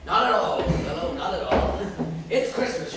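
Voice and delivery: ordinary talk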